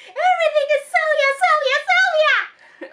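high, throat-straining mock shriek; snapping. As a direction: explode in a jealous screech, drawing out the repeated name. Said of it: "Janet just snaps".

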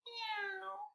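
a timid kitten mewing; scaredy cat meow. Acted. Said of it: "like a scaredy cat".

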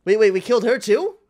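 ordinary delivery

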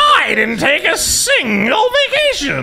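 high, slowed mock boast; hammed-up shouted impression; mocking imitation. Host mocks his ex-colleague's boast of no holidays, high mock shout.

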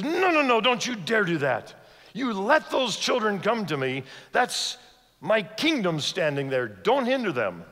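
a fierce rebuke, hammering each line; angry rebuke. Acted. Jesus rebuking the disciples for sending the children away, angrily. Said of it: "explodes in anger".

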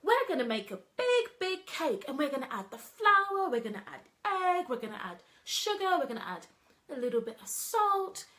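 put-on high and loud, bouncy kids' story voice; excited storytelling voice. Shown as a demonstration. Nursery worker demoing cake make-believe for kids, high and loud. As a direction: build the cake up like magic for toddlers. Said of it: "let me give you an example".

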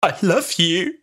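a hint of Rose's choked-up, halting I love you; choked love confession. Fan imitating Rose telling the Doctor she loves him, choked up. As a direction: break it halfway like the words won't come. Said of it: "she goes, like".

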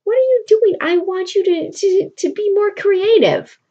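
high, impatient, fed-up question; a lover losing patience, rising high; exasperated Roxanne. Retold or imitated. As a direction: scold him for being so dull. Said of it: "She makes a face at him like".

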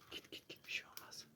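urgent hushed whisper; whisper, push them on gently. Pretend. Urging child forward quietly.

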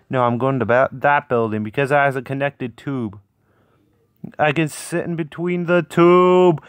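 ordinary delivery

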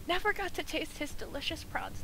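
high, choked-up mock lament; mock tearful. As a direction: play at being moved to tears. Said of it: "hold on, I'm gonna start crying".

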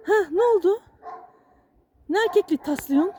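high pet-talk question; talking to a dog. Real, not acted. Owner questions his dog after the barking, high pet-talk.

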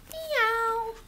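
high, drawn-out meow; cat meow. Acted. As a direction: mew like a cat for little children. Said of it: "what a cat says".

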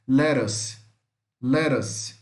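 lettuce as "LE-rus", tapped middle; TT as quick R. Shown as a demonstration. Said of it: "como se fosse um R, muito rápido".

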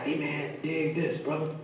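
a smooth hustler talking him into it; laid-back street buddy. Retold or imitated. Boys coaching Micaiah, street talk.

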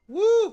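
loud high whoop of joy; excited woo. Real, not acted. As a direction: cheer it out loud. Cheers buying cereal.

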